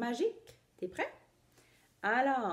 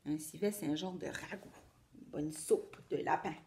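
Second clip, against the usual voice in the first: low, quiet explanation; soft aside; 5 semitones lower, 6 dB quieter than usual. A narrator's reading. Explains word to child.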